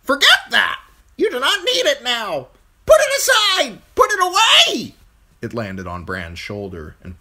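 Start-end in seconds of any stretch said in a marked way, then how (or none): 0.0-4.9 very high, very loud bird screech; shrieking crow. A narrator's reading. Crow ordering Bran to forget the face, shrieking. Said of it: "it shrieked at him".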